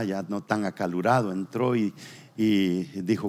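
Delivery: usual speaking voice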